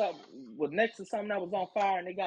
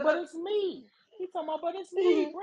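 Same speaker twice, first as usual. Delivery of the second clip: higher, pleading mock voice; whiny manager; 10 semitones higher than usual. Mocking the manager who pleads on the phone, whiny and higher. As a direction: whine like a caught manager.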